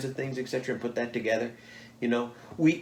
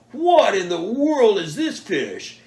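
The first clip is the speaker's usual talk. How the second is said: way up high, louder and slow, stretched in awe; astonished; 10 semitones higher, 8 dB louder, much slower than usual. Retold or imitated. DNR staff stare at the giant pike, high slow astonished. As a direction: gawk at a fish too big to be real.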